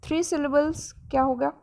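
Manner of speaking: normal talking voice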